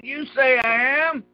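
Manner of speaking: a lightly voiced Jesus, slow and measured; quoting Jesus. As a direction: lay each word down slowly. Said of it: "He said".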